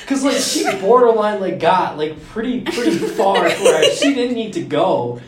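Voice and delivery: high, giggling talk; laughing while telling. Real, not acted. Telling friends the awkward ultrasound, laughing. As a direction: laugh your way through it.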